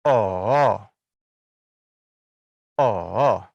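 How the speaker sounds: Oh dipping low, then rising back up; full third tone. Shown as a demonstration. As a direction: draw out Oh, sink low and climb up. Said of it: "nada tiga penuh adalah gini".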